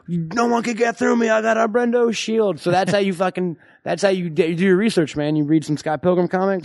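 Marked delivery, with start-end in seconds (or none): 0.1-2.6 higher-pitched cartoonish bragging; mock hero boast. Host imitates Scott Pilgrim shielded by Brendo, higher-pitched mock boast. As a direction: play a cocky hero behind his human shield. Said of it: "and he's like".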